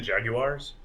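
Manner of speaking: Jaguars as JAG-you-ars, rising like a question; British pronunciation. Retold or imitated. Co-host checks how the British say it, questioning JAG-you-ars for Jaguars. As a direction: try Jaguars with a clear "you" inside. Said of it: "How do they say it?".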